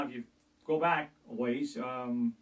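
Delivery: ordinary talk, nothing marked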